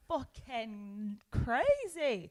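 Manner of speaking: very slow, stretched-out disbelief; drawn-out amazement. Real, not acted. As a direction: drag each word out in amazement. Amazed at ticket sales.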